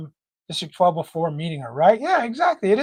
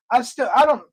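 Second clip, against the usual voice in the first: usual speaking voice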